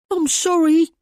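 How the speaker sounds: put-on high, loud, terrified boy's cry; frightened cry. A narrator's reading. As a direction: cry out like a scared boy. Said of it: "he cried".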